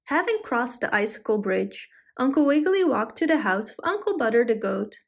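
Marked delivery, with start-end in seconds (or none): none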